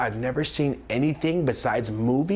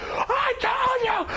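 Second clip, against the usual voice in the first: slow, high-pitched vindicated scream; re-enacted screaming; much slower than usual. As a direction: scream it triumphantly, stretching each word. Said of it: "screaming at her".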